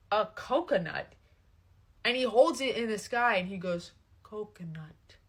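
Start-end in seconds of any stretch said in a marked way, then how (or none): 4.2-5.2 soft, low, hushed single word; understated mock awe; hushed reveal. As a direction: say it quietly, as if presenting something held aloft. Imitates man holding coconut up.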